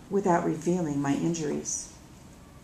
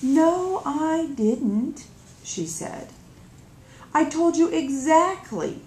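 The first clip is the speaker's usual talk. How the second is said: higher, louder sisterly protest; indignant protest; 8 semitones higher, 4 dB louder, slower than usual. Acted. Little sister protesting to older sister, indignant. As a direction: insist she gave the right directions.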